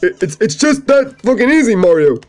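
a cocky, high Luigi. Acted. Luigi voice answering Mario.

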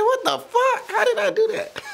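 very high squeal through laughter; retold shock, squealing and laughing; laughing disbelief. Retelling his own shocked reaction.

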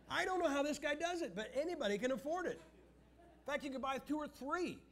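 high, animated amazement; worked-up comic exaggeration; incredulous marvelling. Real, not acted. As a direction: pitch it way up, amazed at the sales trick. Marvels at salesman's persuasive power.

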